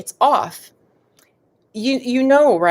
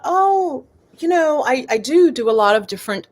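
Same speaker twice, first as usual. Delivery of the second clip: higher-pitched dawning "oh"; pleasant realization; 6 semitones higher than usual. Retold or imitated. Recalling her thought on finding the name, pleasant realization. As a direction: voice lifts as an idea lands.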